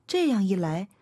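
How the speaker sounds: ordinary delivery, nothing marked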